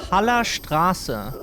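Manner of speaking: Haller Straße read slowly in German, ß as a sharp s; slow careful German reading; German street name. Shown as a demonstration. Streamer showing chat the letter, slow German. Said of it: "one of the cool German letters".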